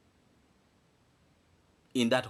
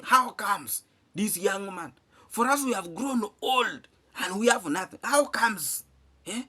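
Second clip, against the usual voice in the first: mock jealous villagers; 6 semitones higher than usual. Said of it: "They're saying".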